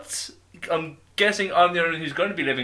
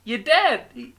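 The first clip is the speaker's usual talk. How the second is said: higher, halting Walken voice breaking into laughter; Christopher Walken impression; 9 semitones higher than usual. Host doing Walken's Pulp Fiction watch speech, laughing. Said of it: "the Christopher Walken impression".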